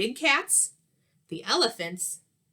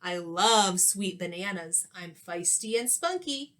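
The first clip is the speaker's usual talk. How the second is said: plain reading voice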